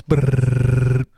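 trilled R sound; rolled r. Shown as a demonstration. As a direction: roll the R, Brrrr. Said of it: "she heard me rolling my R's".

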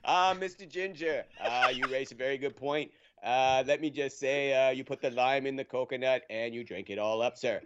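put-on Boston-accented politician fielding press questions. Players' association director addressing reporters, Boston accent. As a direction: do a speechifying official with a Boston accent. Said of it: "you have the best Boston accent".

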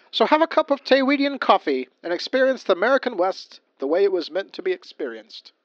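booming, high cowboy ad read; ad announcer voice. Pretend. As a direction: sell it like a corny old radio ad. Mock coffee commercial read.

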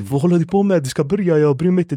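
high, pushy coach voice cutting him off; insistent coach. Retold or imitated. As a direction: insist he has to start, brushing off his doubts.

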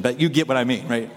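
ordinary talk, nothing marked

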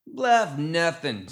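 loud, high, drawn-out dismissal; snorted scorn. A narrator's reading. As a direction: snort it out like a backwoods gremlin. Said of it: "snorted Ma Tuckett".

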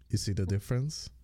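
ordinary talk

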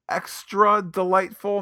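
ironic delight. Acted. Ironic praise of silly scene.

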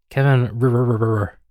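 "R-, R-, R-" rolled on as a looping glitch; glitchy stuck surname. Retold or imitated. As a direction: stutter the R in "Kevin R-" like a jammed machine. Copying the assistant's garbled surname.

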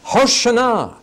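Hoshana shouted high and loud; urgent shouted Hoshana; demanding cry. Shown as a demonstration. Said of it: "it actually is a demand for freedom".

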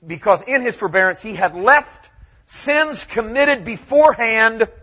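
loud, high-pitched pulpit delivery; full-force preaching. Real, not acted. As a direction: preach it out to the whole church, loud and emphatic.

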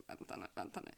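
hushed, voiceless aside; whisper. Retold or imitated. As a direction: whisper it to yourself. Acting out her chat reply. (viskande för sig själv)

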